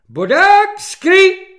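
very high, very loud drill order; full-force captain's yell; shouted command. A narrator's reading. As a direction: bellow it across the parade ground. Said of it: "zvolal kapitán".

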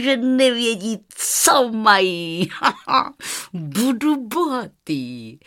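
slow, drawn-out scheming with chuckles; crooked merchant crowing between cackles; gloating villain. A narrator's reading. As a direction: relish the swindle, stretching the words. Sly merchant gloats to himself.

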